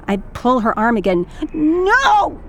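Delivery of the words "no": put-on high, loud child's refusal; little girl's defiant no; stubborn child. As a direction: stamp out a tantrum no. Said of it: "she says, stomps her feet".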